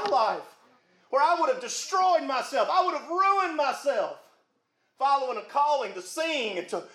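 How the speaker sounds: full preacher's cadence, rising on key words; preaching. Acted. Pastor urging his flock to give something up, preaching. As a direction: preach it up to the back pew.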